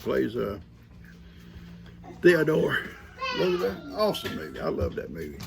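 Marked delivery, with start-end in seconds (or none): none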